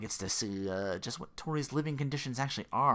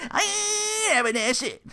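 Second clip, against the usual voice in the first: laughing mock Daniel; 10 semitones higher, 4 dB louder than usual. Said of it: "he's like".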